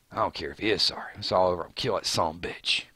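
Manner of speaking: low, quiet, rushed grumble; Daryl. Acted. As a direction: imitate him low and under your breath. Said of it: "Right, that's Daryl's words".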